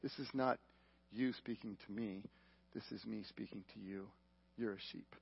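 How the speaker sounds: very soft and low, unhurried; hushed, intimate word, pitched far down; quiet voice of God. Retold or imitated. Preacher relaying God's word to him in the warehouse, hushed. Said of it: "God speaks to me so clear".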